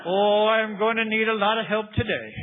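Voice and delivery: hammed-up Irish priest's lament; Irish accent. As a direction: imitate an Irish priest exclaiming. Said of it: "in this great Irish accent".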